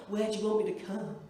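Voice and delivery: low mock-seductive voice; partner's bedroom voice. Partner asking in bed, mock-seductive low voice. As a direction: imitate him, pitched low. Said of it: "He's like".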